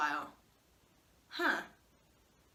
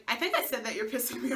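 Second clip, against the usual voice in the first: ordinary talk, nothing marked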